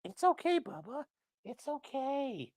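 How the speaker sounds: high, soft, slow reassurance; tender, high pet voice; soothing the dog. Real, not acted. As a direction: hush a panicking pet.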